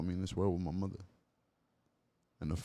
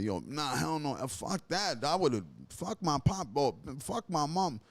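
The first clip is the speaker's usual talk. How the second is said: higher, mock dismissive friend voice; a light take on a scornful guy; imitating other people; 7 semitones higher, slower than usual. Other men rejecting their parents, mock scornful. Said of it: "when people be like".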